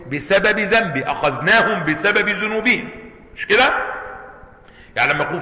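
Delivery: ordinary talk, nothing marked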